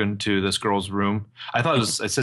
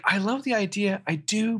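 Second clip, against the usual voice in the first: higher, sweetly hedging; mock hesitant girlfriend; 10 semitones higher than usual. Arya answering Ezra's proposal, higher hedging. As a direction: let him down gently in a lifted voice. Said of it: "And she's like".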